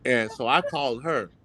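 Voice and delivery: usual speaking voice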